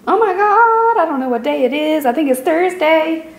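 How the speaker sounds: high-pitched excited outburst; real high-pitched yelling into the camera. Real, not acted. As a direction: call it out high, flustered and excited. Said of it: "Sorry if I'm yelling".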